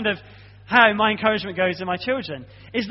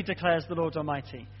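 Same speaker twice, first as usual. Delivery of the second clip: normal talking voice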